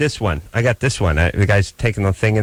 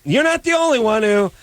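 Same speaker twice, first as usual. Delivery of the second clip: ordinary talk, nothing marked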